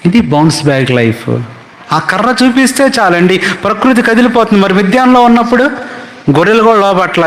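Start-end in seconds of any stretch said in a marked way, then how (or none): 1.9-7.3 rising preaching. Acted. Preacher rising to full force.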